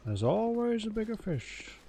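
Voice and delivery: lifted, odd-timbred quote voice; quoting a film, voice shifted up; movie quote voice. Retold or imitated. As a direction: drop a film line in a put-on voice. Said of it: "Whenever someone tries to do a Liam Neeson accent".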